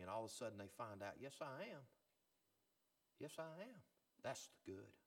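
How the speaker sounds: soft, low, tender pulpit voice; hushed preaching. Real, not acted. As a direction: bring it right down, gently offering each example. Preaches softly with gentle examples.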